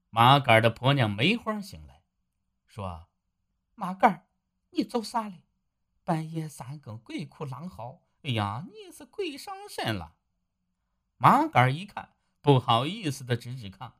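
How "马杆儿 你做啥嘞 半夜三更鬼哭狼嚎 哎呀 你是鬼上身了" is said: a sleepy wife scolding her husband for howling; wife Meihua. Acted. Wife Meihua woken at night. (略高的妻子声音，带点惊慌责备)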